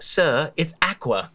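slow, high "Sir, it's aqua." with a British "aqua"; mock British correction. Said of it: "and they're like".

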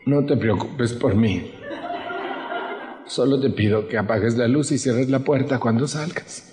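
mock long-suffering sigh of a martyr; martyr guilt trip. Speaker imitating a self-pitying relative, mock martyr tone. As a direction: lay on the guilt, all sweetness.

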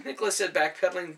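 ordinary delivery

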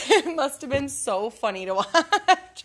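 laughing. Real, not acted. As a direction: giggle as you picture how silly you looked. Laughing at her snake fight.

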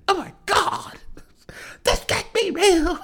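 very high, slow, stunned disbelief; mock horrified fan. Voicing a woman staring at the scoreboard, horrified. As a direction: gasp it like she's seen a ghost.

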